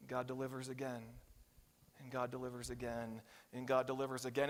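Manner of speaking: quiet preacher's litany; hushed refrain. Real, not acted. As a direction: let each God delivers again sink in.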